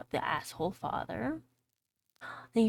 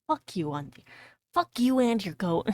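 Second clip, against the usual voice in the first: angry outburst; 6 dB louder than usual. Real, not acted. Streamer yelling at story character.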